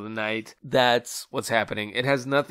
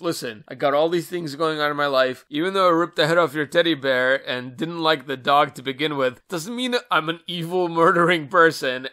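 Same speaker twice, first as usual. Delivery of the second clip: mock excuse; 4 semitones higher, 7 dB louder than usual. Voicing Nancy's excuse.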